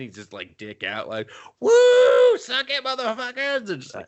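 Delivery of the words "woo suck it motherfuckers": high-pitched whoop and jeer; imitated taunting holler, pitched way up; mock gleeful taunt. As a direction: whoop it like a cartoon show-off. Acting out helicopter flyby taunt.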